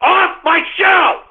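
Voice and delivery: slow, high, shouted dismissal; furious slow yell; angry shout. Real, not acted. Ranting host ends a tirade.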